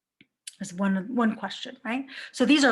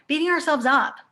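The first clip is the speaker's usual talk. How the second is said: emphatic; 5 semitones higher, 6 dB louder than usual. Acted. Repeats phrase with emphasis.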